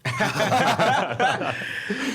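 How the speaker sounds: loud high laugh; high burst of laughter. Real, not acted. Reacting to disbelief.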